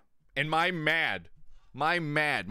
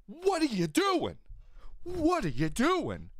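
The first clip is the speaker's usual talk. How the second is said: exasperated; 12 semitones higher, slower than usual. Pretend. Mock exasperated outburst.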